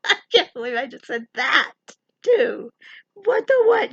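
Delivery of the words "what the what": high startled outburst; sudden squeaky disbelief; what the what. Real, not acted. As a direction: yelp it in surprise.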